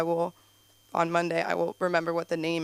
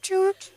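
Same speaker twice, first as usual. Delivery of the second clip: high, loud playful choo choo; mock train choo; 10 semitones higher, 5 dB louder than usual. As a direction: toot it like a toy train. Mock train sound in story.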